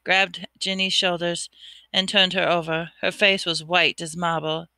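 usual speaking voice

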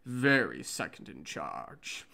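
low, slow put-on voice for Saad; slow, deep, deadpan Saad line; Saad's deep voice. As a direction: drop the voice low and drag it out.

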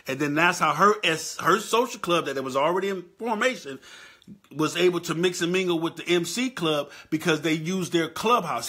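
worked-up rant. Real, not acted. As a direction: rant it hot and loud, defending his homework.

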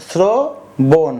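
"শ্রবণ" very slowly as sro, bon; syllable by syllable. Shown as a demonstration. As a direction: draw out "শ্রবণ" in two separate parts. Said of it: "তাহলে উচ্চারণ হলো". (ভেঙে ধীরে "শ্রবণ")

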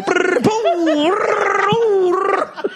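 high buzzing brrr and boom sounds; playful sound effects for a wipeout; imitated tumbling noises. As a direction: mimic a body rolling and bouncing. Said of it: "He'd go".

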